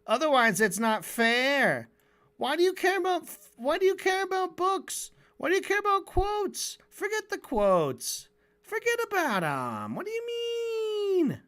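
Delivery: mock him as a petulant little worm, way up high; a high sniveling caricature of Benny, ending in a squealed question; whiny Benny Morris. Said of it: "he's like".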